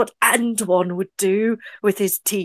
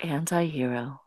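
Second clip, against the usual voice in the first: low, hushed whisper; spooky whisper; 8 semitones lower, 8 dB quieter than usual. Pretend. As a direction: lure him in softly. Luring Anti-Hero in.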